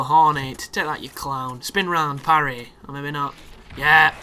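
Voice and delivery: usual speaking voice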